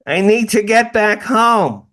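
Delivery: hammed-up impatient girlfriend; mocking girlfriend. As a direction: girlfriend brushing off the sighting, mock high voice. Girlfriend's reply voiced.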